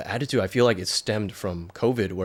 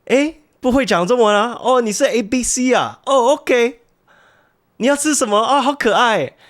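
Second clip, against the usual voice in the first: put-on much higher, sing-song auntie voice cooing at a little kid; friendly Taiwanese shopkeeper; 14 semitones higher, 8 dB louder than usual. Shopkeeper coos at the little American-born boy, high and sing-song. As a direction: coo at the child like a doting vendor. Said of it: "The people would be like".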